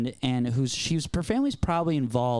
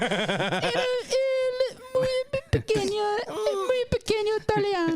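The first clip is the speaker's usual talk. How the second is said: high, squeaky fake Italian accent; slower than usual. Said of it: "Speaking Spanish in an Italian accent".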